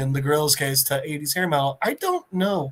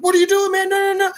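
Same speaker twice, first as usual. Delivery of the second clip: high-pitched, louder fussing; high, flustered scolding; alarmed protest; 14 semitones higher, 4 dB louder, faster than usual. Acted. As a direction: object in a panicky high voice. Peacemaker protests gorilla touching radio.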